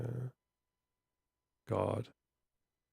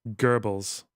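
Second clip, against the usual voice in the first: British Goebbels; 2 semitones lower than usual. Retold or imitated. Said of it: "In the U K".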